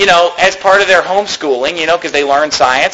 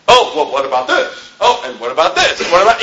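lightly voiced scoffer, higher, pestering; a hint of a whiny objector; mocking atheist; 5 semitones higher than usual. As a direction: needle with endless questions. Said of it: "it just goes on forever".

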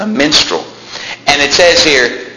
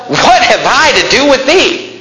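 high, slow, indignant rebuke; angry prophet; 10 semitones higher than usual. Retold or imitated. Elisha rebuking the king of Israel, indignant. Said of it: "do you see the anger?".

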